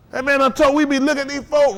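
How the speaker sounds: ordinary talk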